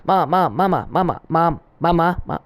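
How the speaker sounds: rapid, relentless pestering chant, pitch climbing; Stewie. Retold or imitated.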